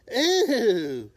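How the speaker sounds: loud, high disgusted ew. Acted. Bat recoiling in disgust at eating bugs. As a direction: recoil from the thought.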